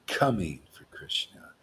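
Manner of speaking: soft unvoiced whisper. Real, not acted. Preacher whispering a cheeky double meaning. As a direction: breathe it like a secret.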